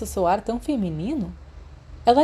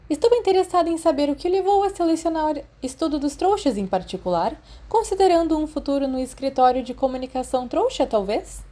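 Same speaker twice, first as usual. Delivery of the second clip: a touch higher, a probing teacher's question; hint of the old professor testing her student; lightly voiced stern professor; 7 semitones higher than usual. A narrator's reading. Old professor quizzes Remus on his subject choices, lightly voiced stern.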